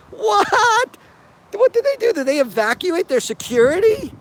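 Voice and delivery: real shocked what; stunned disbelief. Real, not acted. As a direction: gasp it out, can't believe it. Reacting stunned to the clip.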